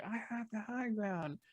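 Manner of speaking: high, lilting far-off delivery; Ewan's imitated line. Actor calling his line as he turns the corner, high imitated voice. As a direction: toss it back softly in a high voice. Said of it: "I hear him say".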